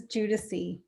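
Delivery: Giudici said as JOO-duh-see; pronouncing a surname. Shown as a demonstration. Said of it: "pronounce your last name".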